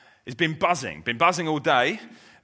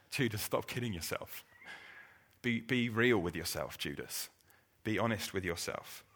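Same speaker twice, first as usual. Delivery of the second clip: quiet, firm appeal; 4 semitones lower, 9 dB quieter than usual. Retold or imitated. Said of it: "Jesus just says".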